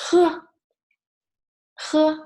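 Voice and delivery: "He" with friction vibrating in the throat. Shown as a demonstration. Teacher models the sound, "he" with vibrating throat. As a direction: let the throat vibrate on each "he". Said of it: "make your throat vibrating".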